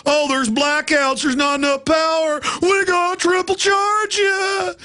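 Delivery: high, slow, hammed-up fake worry; mock whiny excuse. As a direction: whine it up high and slow like a con man faking concern. Mocking Enron's fake excuses.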